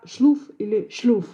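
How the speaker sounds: "шлуф" with u, then "шлюф" with fronted u; southern u in шлуф. Shown as a demonstration. Linguist cites Ukrainian shtetl forms, "шлуф" and "шлюф" with u. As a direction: say "шлуф или шлюф" with u in place of o. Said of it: "соответствует южное".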